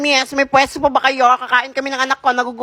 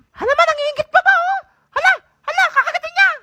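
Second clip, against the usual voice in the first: put-on whiny child voice; 13 semitones higher than usual. Hungry kid watching.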